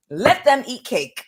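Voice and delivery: high, drawn-out crowing; gleeful proclamation. Real, not acted. Tarot reader repeating her verdict to viewers, gleeful proclamation. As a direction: sing it out slowly, high-pitched.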